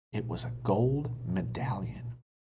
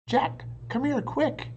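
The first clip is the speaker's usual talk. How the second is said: urgent call; 14 semitones higher, 3 dB louder than usual. A narrator's reading. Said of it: "Annie called".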